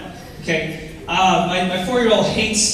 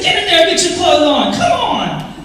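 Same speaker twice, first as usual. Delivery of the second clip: higher, louder mock yell at the kid; exasperated, half-laughing parental order; parent scolding; 9 semitones higher, 6 dB louder than usual. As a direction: bark it like a dad shooing a naked kid back inside. Parent scolding naked son.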